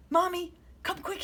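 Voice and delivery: high frightened call; scared cry. A narrator's reading. Child calls mommy after seeing a tail, high scared. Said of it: "he cried".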